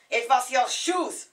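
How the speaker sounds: slow, loud, furious accusation; angry outburst. Retold or imitated. Furious companion blaming her shoes for the broken bike, slow loud anger. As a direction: hurl the blame at her, word by word. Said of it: "his ears boiled like a radiator".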